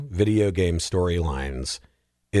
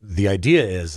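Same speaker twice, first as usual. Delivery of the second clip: usual speaking voice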